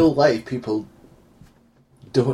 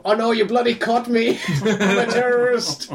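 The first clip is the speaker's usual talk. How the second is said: laughing mock panic; 8 semitones higher than usual. Mock terrorist caught, laughing.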